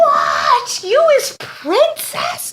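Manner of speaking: very high, drawn-out astonished voice; mock amazement. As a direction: gasp it slowly, hammed-up and high. Mock shock at princess reveal.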